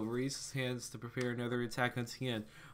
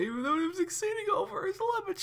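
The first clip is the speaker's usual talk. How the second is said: very high, loud, rushed squeal; hammed-up high-pitched dramatic voice; high squeaky reading; 4 dB louder than usual. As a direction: read it in a high, frantic squeak. High put-on story voice, over the top.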